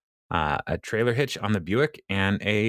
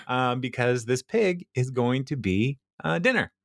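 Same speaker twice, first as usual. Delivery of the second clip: normal talking voice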